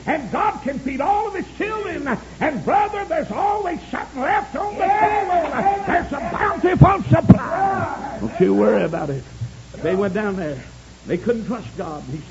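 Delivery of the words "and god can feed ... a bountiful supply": high, loud revival preacher; high-pitched pulpit cry; full-force preaching. Acted.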